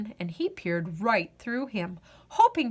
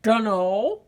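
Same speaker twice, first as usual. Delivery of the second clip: growled; 4 dB louder than usual. Acted. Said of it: "growled the ghoul".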